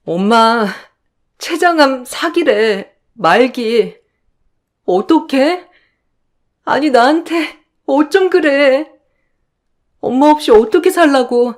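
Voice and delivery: 높아진 목소리로 흐느끼듯 호소; 무너지는 마음으로 울먹이며 묻기; 울며 하소연. Acted. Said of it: "전 엄마 옆에서 펑펑 울었어요". (엄마 곁에서 펑펑 울며 하소연하는 딸)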